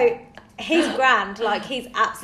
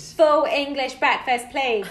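slow, deliberate ordering; measured Brit-abroad English; slower and louder; 2 semitones higher, slower than usual. Acted. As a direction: enunciate for someone who barely knows English. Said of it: "speak slower and louder till someone understands".

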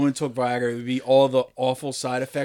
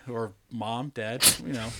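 ordinary talk, nothing marked

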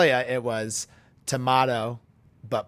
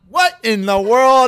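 higher, louder, slow mock disbelief; mock outrage; 8 semitones higher, 7 dB louder than usual. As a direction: ham up the shock.

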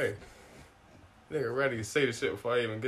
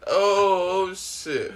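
ordinary talk

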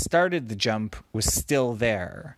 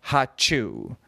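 sneeze. Acted. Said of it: "a lion who could only say".

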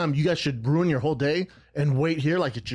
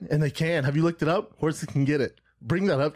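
ordinary delivery

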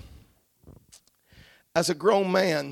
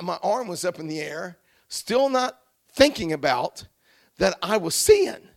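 ordinary delivery

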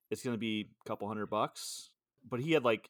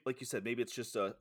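ordinary talk, nothing marked

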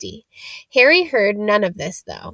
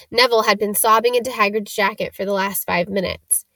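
ordinary delivery, nothing marked

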